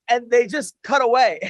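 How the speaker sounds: ordinary talk, nothing marked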